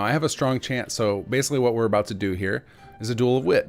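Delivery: normal talking voice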